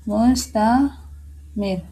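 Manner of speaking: मुस्तामिर stretched very slowly and softly; मुस्तामिर drawn out very slowly; very slow demo. Shown as a demonstration. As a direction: let मुस्तामिर fade out slowly. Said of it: "इसके example में देख लें".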